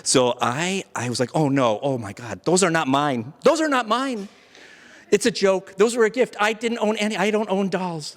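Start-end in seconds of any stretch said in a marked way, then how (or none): 1.3-8.2 high, rushed, flustered protest; panicked denial. Retold or imitated. Accused man panicking to the social worker, high flustered denial. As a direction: scramble to explain in a panic.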